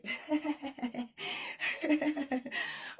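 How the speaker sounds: high delighted giggle; excited giggle. Real, not acted. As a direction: giggle with glee. Excited giggle at good news.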